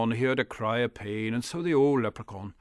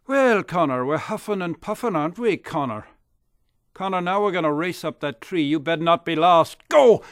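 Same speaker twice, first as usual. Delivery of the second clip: a jeering bully, louder and higher; mock him then bark the start; loud bully taunt; 4 semitones higher, 10 dB louder than usual. Bully Dirk taunts Connor.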